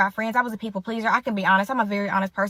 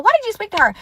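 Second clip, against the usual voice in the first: high, louder imitated reproach; accusing question; 10 semitones higher, 4 dB louder, faster than usual. Friend confronting her for greeting mom, high accusation. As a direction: demand it high, as the annoyed friend.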